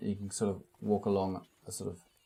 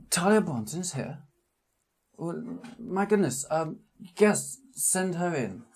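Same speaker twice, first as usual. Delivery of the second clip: small man voice; 9 semitones higher than usual. Acted.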